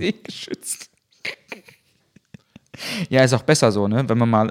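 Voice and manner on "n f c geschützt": whispered mock. Mocking repeat of plot device.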